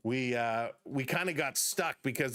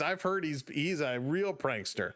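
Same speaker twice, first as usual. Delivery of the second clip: ordinary talk, nothing marked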